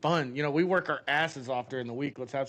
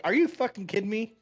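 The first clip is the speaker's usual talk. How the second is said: exasperated disbelief; 5 semitones higher than usual. Retold or imitated. Exasperated at the girl.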